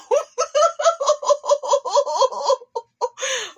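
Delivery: high cackling ugly laugh. Real, not acted. Host cackling at her own joke, an ugly high laugh. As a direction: let out a loud ugly cackle. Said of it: "that's that ugly laugh".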